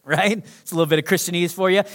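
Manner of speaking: ordinary delivery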